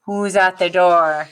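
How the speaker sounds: ordinary talk, nothing marked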